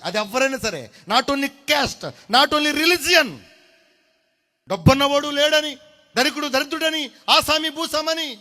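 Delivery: high, loud impassioned sermon cry; fiery preaching. Acted. Pastor urging the church to weep before God, loud impassioned cry. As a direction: cry it out to the crowd.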